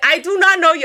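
acting out yelling at a stranger; retold yelling. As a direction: call it out, each word punched. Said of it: "I started yelling".

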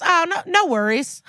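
a sing-song high no worries; chirpy high voice. Retold or imitated. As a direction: toss it off in a squeaky high voice. Lucy's breezy reply, voiced.

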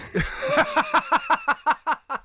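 high squealing laugh; real shrieking laugh at the personal question. Real, not acted. Stunned by quiz question.

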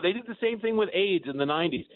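ordinary talk, nothing marked

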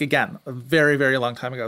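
normal talking voice

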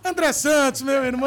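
announcer shout. Acted. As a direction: call the guest's name like a showman. Host announcing guest.

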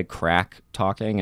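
ordinary delivery

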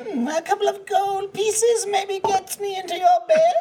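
high, slow, laughing sleazy voice; laughing through a creepy come-on; creepy flirty character voice. Retold or imitated. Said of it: "I was like".

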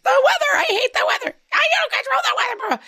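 very high, strained shrieking; mock tantrum screaming. Parent and kid fighting over weather, hammed-up screaming. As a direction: shriek it fast in an exaggerated meltdown.

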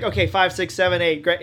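mock choreographer driving the room; rehearsal count-off. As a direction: count the dancers in, loud and businesslike. Mimics a brisk rehearsal count-off.

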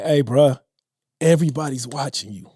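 quiet, voice catching with real emotion; real emotion, nearly breaking; choked up. Real, not acted. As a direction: hold back tears on the words. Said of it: "I'm getting choked up even saying this".

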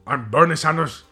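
put-on loud, gruff old politician voice; Bernie Sanders. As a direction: do a hammed-up old senator. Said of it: "Old Bernie".

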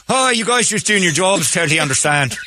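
put-on chummy Irish accent laid on thick. Cuffed man sweet-talking the police in an Irish accent. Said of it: "you go into your Irish accent".

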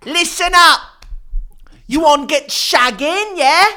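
loud, high mock Cockney bark; Cockney phone sex. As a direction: shout it like a Cockney barker.

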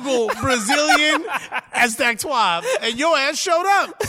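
high, loud, laughing punchline; laughing confession. Acted. Comic admitting to the player he searched him, laughing. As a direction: shout it high through your laughter.

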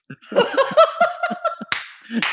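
big high-pitched laugh; high burst of laughter. Real, not acted. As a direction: crack up laughing. Bursts out laughing at own joke.

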